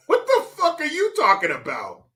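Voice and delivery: high-pitched incredulous cry; exasperated outburst. Acted. Host reacts to the muddled answer, exasperated.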